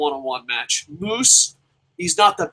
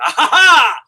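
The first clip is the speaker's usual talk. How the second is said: high shouted ha ha laugh; big mocking belly laugh; loud mocking laugh; 11 semitones higher, 8 dB louder than usual. Laughing at Elgin.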